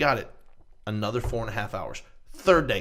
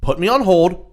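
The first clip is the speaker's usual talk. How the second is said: much louder, higher at first, cutting the agent off; angry shout; 5 semitones higher, 10 dB louder, slower than usual. Retold or imitated. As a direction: bark orders at the phone agent. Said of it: "He goes".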